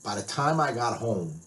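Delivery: ordinary talk, nothing marked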